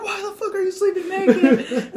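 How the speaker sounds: high, loud incredulous mock yell; yelling back. Storyteller yelling at naked friend, incredulous shout. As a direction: shout the question, half laughing. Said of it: "while you're both yelling".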